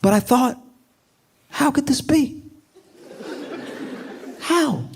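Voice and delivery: high, bewildered rioter; high, stunned mock confusion; mock disbelief. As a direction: whine it in shocked disbelief. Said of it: "they're like".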